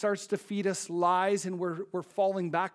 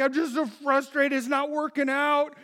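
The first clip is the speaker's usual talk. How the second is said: higher, mildly whiny complaint; frustrated roommate; 7 semitones higher, 5 dB louder than usual. Retold or imitated. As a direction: sound defeated by dating. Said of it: "he's like".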